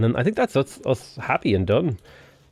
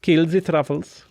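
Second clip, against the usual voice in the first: slow mock German accent, slightly raised; silly German accent; 5 semitones higher than usual. Gamer correcting pigs to truffles, silly German accent. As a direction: put on a playful German accent. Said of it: "do that in a German accent".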